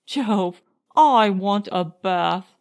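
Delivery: usual speaking voice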